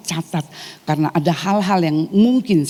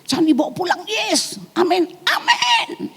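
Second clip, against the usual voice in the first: mocking the congregation; 14 semitones higher than usual. Preacher mimics congregation promising not to take fear home, mock high amens. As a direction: parody a churchgoer's empty amen, slowing at the end. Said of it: "Tapi biasanya dari kita".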